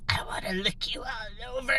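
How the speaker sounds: hot, creepy breath in the ear; creepy whisper. Retold or imitated. Reenacting her creepy whisper for viewers. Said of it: "imagine this hot, creepy breath".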